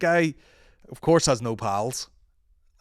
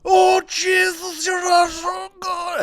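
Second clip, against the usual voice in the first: put-on very high, loud, drawn-out botched Irish accent; 9 dB louder, much slower than usual. Host imitating an actor's hometown brogue, shrieked botched Irish accent. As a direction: shriek a hammed-up Irish accent that goes astray. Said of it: "what accent I'm doing".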